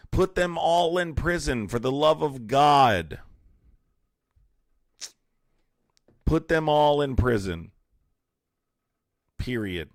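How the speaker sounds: furious rant. Real, not acted. Ranting about locking them up.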